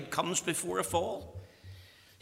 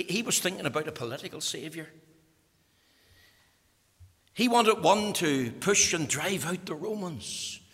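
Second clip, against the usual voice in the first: normal talking voice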